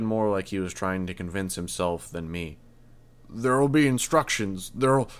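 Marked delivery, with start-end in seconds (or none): 3.3-5.1 louder, higher urgent shouting; roar. A narrator's reading. The desperate mayor roaring at the hero over his son. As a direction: yell to force the hero to act. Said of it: "The mayor's voice was a roar".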